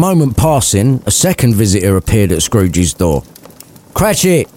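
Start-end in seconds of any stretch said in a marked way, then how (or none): 3.9-4.5 yelled. Acted. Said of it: "Scrooge yelled to his assistant".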